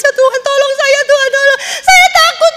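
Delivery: high, panicked pleading to God; high sobbing plea for help; frightened crying prayer. Retold or imitated. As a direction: beg God for help through tears. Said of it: "saya ketakutan, saya menangis".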